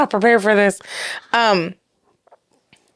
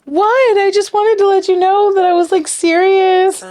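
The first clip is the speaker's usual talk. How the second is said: high-pitched, louder mock defensiveness; imitated earnest protest; 10 semitones higher, 4 dB louder than usual. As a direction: play the wounded dater, pitched way up. Dater protests to the hosts.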